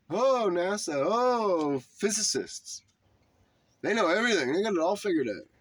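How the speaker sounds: high, sing-song sarcastic gushing; mock awe. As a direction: gush in mock worship of the experts. Mocking blind worship of physicists.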